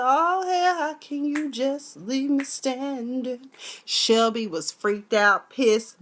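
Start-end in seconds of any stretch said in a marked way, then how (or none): 0.0-3.5 high, slow cry of dismay; hammed-up high indignant plea; mock outrage. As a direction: wail it, hurt and offended. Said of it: "I said".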